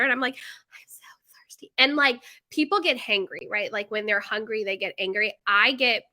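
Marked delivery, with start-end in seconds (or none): 0.4-1.7 hammed-up voiceless whisper; parched whisper. Mom to kids who want her water, mock parched whisper. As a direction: whisper it as if dying of thirst.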